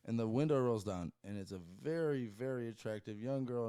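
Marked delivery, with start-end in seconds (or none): none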